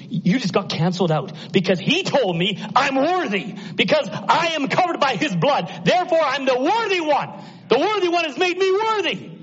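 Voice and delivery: very high, triumphant proclamation; fervent pulpit cry of victory; defiant preaching. Acted. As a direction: declare it climbing to a ringing peak. Preacher answers Satan defiantly.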